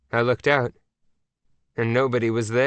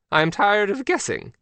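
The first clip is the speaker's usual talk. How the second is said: higher, impatient royal retort; impatient queen; 9 semitones higher than usual. Acted. The Queen rebuffs Mac's guessing game, impatient. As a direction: snap back, fed up with guessing. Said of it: "I told you".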